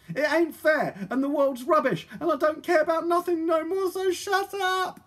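very high, loud tearful wail; sobbing. A narrator's reading. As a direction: wail the complaint, stretching the end. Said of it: "she sobbed".

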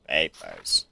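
very low, soft put-on deep voice. Gamer voicing the checkpoint inspector, very deep. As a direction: rumble it low from the chest. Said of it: "I'll just do a deep voice".

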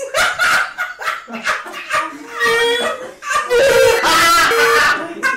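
high shrieking laughter; loud laughter. Real, not acted. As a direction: howl with laughter. Bursts out laughing.

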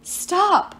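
urgent, pleading cry. Acted. Piper begs the boys not to fight, pleading cry. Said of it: "Piper pleaded".

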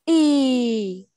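Y held long as a steady "ee"; long vowel. Shown as a demonstration. Teacher showing what two dots mean, Y held long. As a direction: draw Y out, starting firmly and falling away. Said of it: "nó dài hơn xíu đó".

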